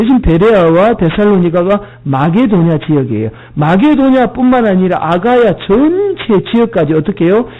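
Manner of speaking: usual speaking voice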